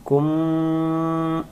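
কুম held long with a nasal mim; slow recited কুম; drawn-out কুম. Shown as a demonstration. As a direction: stretch the কুম with the closed mim. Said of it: "আর যদি বলি কুম".

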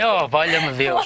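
drawn out, low, put-upon; a slow weary groan of a phrase; slow exasperated sigh. Retold or imitated. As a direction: let it out slow like a weary man. Teller reacts to himself.

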